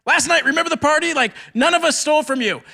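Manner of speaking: high, fast, frantic denial; pleading. Retold or imitated. Brothers plead innocence to the Egyptian servants, high and rushed. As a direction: beg them to believe you're innocent. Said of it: "pleading with them".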